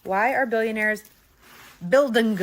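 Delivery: loud, rising, building to a shout on building; excited shout. Real, not acted. Reading out the misprinted shirt.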